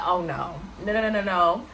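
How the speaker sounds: rapid string of retold refusals; firm refusal. Retelling her refusal to her date in the Uber, firm. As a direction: shut the idea down at once. Refuses what he's about to do.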